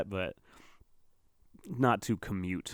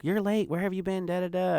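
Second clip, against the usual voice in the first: much higher, nagging boss voice; mock scolding manager; 10 semitones higher than usual. Bartender mimicking the manager who scolds him for lateness, high mocking voice. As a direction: squeak out the manager's nagging like a caricature.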